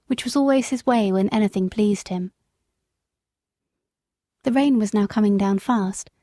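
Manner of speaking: ordinary delivery, nothing marked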